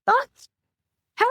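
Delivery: high-pitched attempt at a British accent. Player mimicking a halfling woman for the game master, high mock British. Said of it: "So high-pitched British, is that what".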